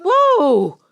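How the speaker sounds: high, loud whoa; shocked exclamation; startled whoa. Acted. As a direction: cry out mid-fall. Passing through another wall.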